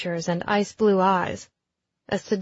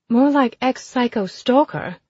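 higher, louder quip; mocking brash lady; 5 semitones higher, 6 dB louder than usual. A narrator's reading. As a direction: toss off the jab. Ezzy answers mockingly.

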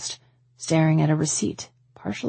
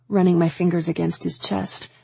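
ordinary delivery

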